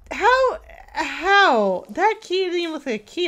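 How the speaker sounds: exasperated how; incredulous yelp at the game; baffled outcry. Real, not acted. Key makes no sense.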